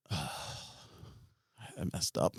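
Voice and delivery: small, low, quiet admission; sheepish realization. Retold or imitated. Looking round at his guys surrounding her, quiet sheepish realization. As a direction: let it sink in, soft and low.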